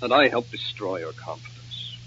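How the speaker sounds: low, very soft lament; remorseful. Acted. Nicky blames himself for Nora's nerves, remorseful murmur. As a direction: murmur it heavily, full of regret. Guilty man frets about Nora.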